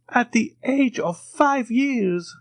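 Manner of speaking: slow, high, mournful; deep sigh. A narrator's reading. The major mourns his lost son, slow and high. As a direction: draw it out with a heavy sigh. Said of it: "said the major with a deep sigh".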